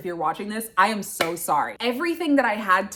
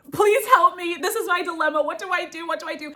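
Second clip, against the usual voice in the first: pleading; 7 semitones higher, faster than usual. Retold or imitated. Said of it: "I was like".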